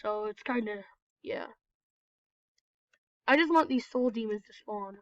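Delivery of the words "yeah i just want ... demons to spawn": ordinary talk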